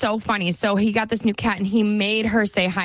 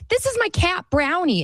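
put-on much higher proud little-boy voice; child voice; 10 semitones higher than usual. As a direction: show off the kitten like a small kid. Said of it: "he was like".

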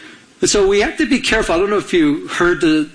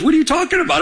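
high-pitched mock disbelief; incredulous retort; 11 semitones higher, much faster than usual. God answers the drowned man's complaint, incredulous retort. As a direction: act out exasperated astonishment.

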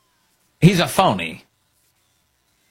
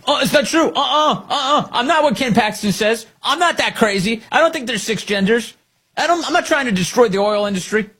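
high frantic whiny denials, higher; panicky mock candidate; 10 semitones higher than usual. Host mocking a rival's panicky ad, high and frantic. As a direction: mimic a panicking politician denying everything. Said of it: "a very panicky new ad".

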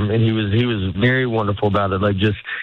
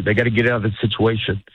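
ordinary delivery

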